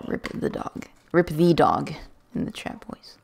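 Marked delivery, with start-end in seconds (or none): 0.1-0.9 soft whispered little eulogy for the dog; mock-solemn whispered farewell; hushed mourning. Real, not acted. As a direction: breathe it out like a tiny funeral. Mourning the dog, then repeating plainly.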